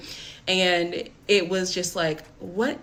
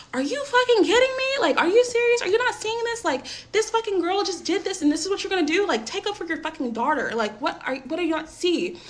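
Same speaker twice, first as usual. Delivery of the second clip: furious yelling; 10 semitones higher than usual. Retold or imitated. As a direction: scream it out, losing control. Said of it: "I've lost my shit".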